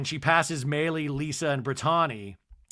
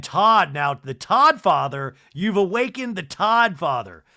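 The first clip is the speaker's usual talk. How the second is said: dramatic announcement; 4 semitones higher, 7 dB louder than usual. Acted.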